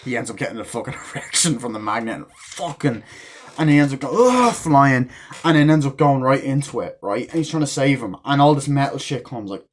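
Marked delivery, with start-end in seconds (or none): 4.2-4.5 high, loud mock cry of impact; imitated yanked grunt. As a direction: yelp like someone suddenly yanked away. Imitates man yanked flying.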